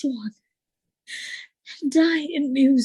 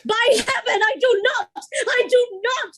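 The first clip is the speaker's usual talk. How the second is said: higher and louder, insisting; desperate protest; 8 semitones higher, 4 dB louder than usual. Acted. Emilia protesting to Othello against Iago, loud. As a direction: cry out your denial.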